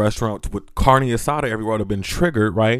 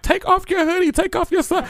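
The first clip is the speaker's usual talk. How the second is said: high-pitched teasing voice. Retold or imitated. Friends urging him at party.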